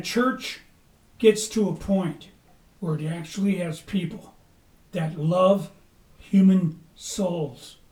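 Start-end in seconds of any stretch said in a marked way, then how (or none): none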